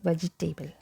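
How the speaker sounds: vegetable as VEJ-ee-TAY-bul; common mispronunciation. Retold or imitated. Teacher imitates how people get confused, mispronounced vegetable. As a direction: say "vegetable" with table at the end. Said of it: "people pronounce it like".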